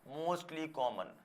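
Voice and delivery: slow explanation. Shown as a demonstration. Teacher glossing a word slowly.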